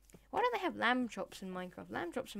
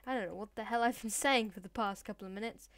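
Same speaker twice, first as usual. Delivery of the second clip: usual speaking voice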